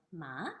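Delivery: "ma" rising from low; second tone. Shown as a demonstration. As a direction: lift "ma" like a question. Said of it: "Second tone".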